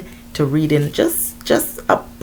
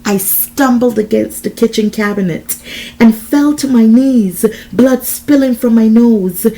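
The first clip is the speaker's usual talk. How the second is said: loud, raised-pitch, intense storytelling; dramatic narration; 7 semitones higher, 7 dB louder than usual. A narrator's reading. Desiree recounting the attack, intense dramatic narration. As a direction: narrate the attack with full urgency.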